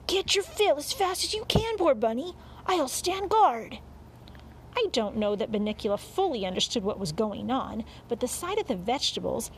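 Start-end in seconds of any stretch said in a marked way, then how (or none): 0.1-3.8 high, urgent encouragement; urgent urging. A narrator's reading. Dog urging the hungry bunny to eat, urgent. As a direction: urge him on in a high, pressing voice.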